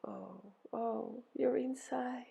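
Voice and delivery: hushed, high sympathetic moan; soft echoed moan. A narrator's reading. Helpers echo Ereshkigal's pain, soft empathetic moan. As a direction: moan it back softly in empathy. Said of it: "They moaned".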